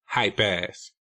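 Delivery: sneering contempt. A narrator's reading. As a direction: sneer it out with scorn. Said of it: "I sneered".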